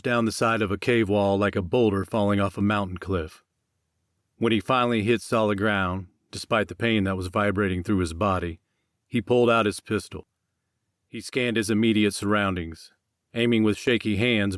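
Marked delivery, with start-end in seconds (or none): none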